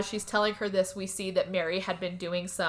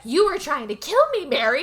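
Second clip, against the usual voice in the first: louder, higher angry accusation; furious accusation; 7 semitones higher, 6 dB louder than usual. Retold or imitated. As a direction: throw it at her like a betrayal. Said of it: "was, like, super pissed off".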